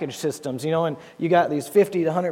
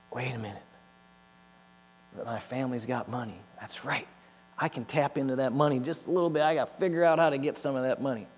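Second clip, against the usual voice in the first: much softer, conspiratorial inner voice; scheming aside; 6 dB quieter, faster than usual. Retold or imitated. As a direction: plot it quietly to yourself. Scheming inner thought about family money.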